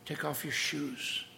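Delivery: preacher hushing the congregation into awe; hushed command. Acted. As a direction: whisper the holy order. Hushed repeat of God's command.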